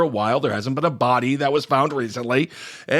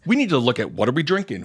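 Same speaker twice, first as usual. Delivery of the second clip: ordinary talk, nothing marked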